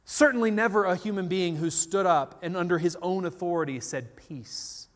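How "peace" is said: breathy whisper of the word; soft whispered command; whispered peace. Retold or imitated. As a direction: hush the storm. Jesus speaks to the storm.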